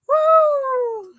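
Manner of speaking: long, very high, loud woo; amused whoop. Real, not acted. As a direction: let out a high overwhelmed whoop. Reeling from the messy clip.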